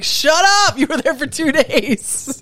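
high exasperated shout; exasperated friends. Retold or imitated. Friends snapping at the returned New Orleans braggart, high exasperated shout. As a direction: snap at the bragging tourist. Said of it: "and everyone's like".

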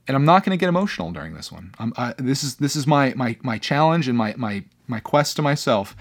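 ordinary delivery

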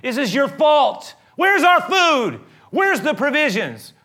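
a crowd screaming at Moses about starving; furious complaint. Retold or imitated. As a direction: shout the blame and demand food. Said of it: "It's like".

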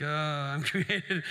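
slow, dragging, half-laughing reluctance; reluctant mock groan. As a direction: say it like a burden you're stuck carrying. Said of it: "Sounds like a burden that we're carrying".

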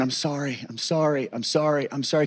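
soft, repeated retold apologies; retold hushed apology. Repeating apologies to wife and friends who found him, hushed. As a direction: keep apologizing under your breath, as if ashamed.

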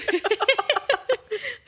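playful high-pitched giggle; high teasing laugh. Real, not acted. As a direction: laugh at your own joke. Teasing co-host about anger.

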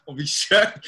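slow, drawn-out hyped reply; mock fired-up kid; eager. His younger self answering his coach, slow and hyped. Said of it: "I was like".

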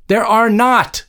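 high-pitched exasperated outburst; narrator's contained scream. A narrator's reading. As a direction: cry out in furious contradiction. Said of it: "screamed Joan".

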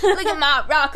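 loud gleeful brag; excited show-off; mock excited. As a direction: brag about the pebble. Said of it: "I'm gonna be like".

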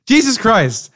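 sharp high startled outburst; startled exclamation. Real, not acted. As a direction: blurt it out in shock.

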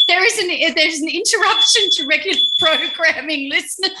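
bright hammed-up broadcast voice; mock announcer breaking into the show; mock radio announcer. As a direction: play the radio host cutting in. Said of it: "announces interruption like radio host".

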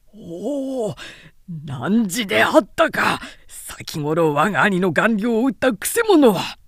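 a warrior's mild roar of recognition; shouting back. Acted. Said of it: "おめき合わせて".

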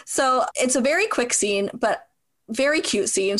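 ordinary talk

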